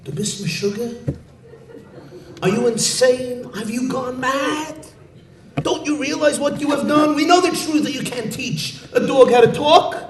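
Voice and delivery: high, frantic scolding; rebuking. Acted. Community head rebuking Chaim Yankel, high frantic scolding. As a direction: berate him in panic. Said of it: "begins rebuking him and chastising him".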